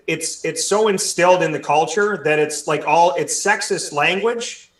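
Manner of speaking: ordinary delivery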